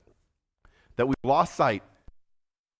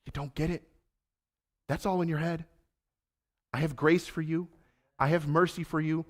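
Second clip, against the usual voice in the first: reassuring Jesus; 3 semitones higher, 6 dB quieter than usual. Acted. Jesus voiced softly reassuring.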